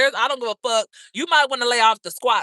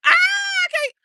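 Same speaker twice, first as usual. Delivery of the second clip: high, loud, cry-laughing; can't breathe from laughing; laughing scream; 4 dB louder, slower than usual. Real, not acted. Laughing at her own joke to viewers, shrieking. Cracking up at herself.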